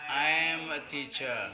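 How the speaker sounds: drawn-out classroom English; slow, careful example for the class; slow model sentence. Shown as a demonstration. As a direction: stretch each word for learners.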